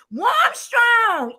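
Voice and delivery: loud, high excited holler; excited shout-out. Real, not acted. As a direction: holler the guest's name in delight. Greets guest with a shout.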